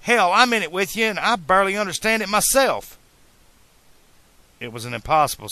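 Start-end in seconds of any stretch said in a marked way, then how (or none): 0.0-3.0 high, loud outburst; exasperated. A narrator's reading. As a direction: protest in frustrated disbelief. Marty argues with stubborn Doc.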